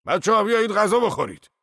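high, loud call through a mouthful; mouth full. A narrator's reading. Zorba inviting workers to eat, mouth full. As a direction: call them over while chewing. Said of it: "در حالی که دهانش پر بود گفت".

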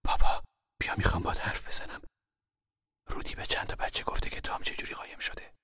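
hushed, near-whispered confidence; quiet, confiding mother. A narrator's reading. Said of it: "مادر آهسته گفت".

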